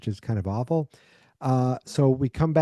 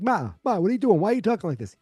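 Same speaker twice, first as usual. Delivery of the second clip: much higher, alarmed imitated plea; a son baffled and alarmed, voice up high; shocked pleading; 9 semitones higher, faster than usual. Son to raging mother, mock-alarmed high plea.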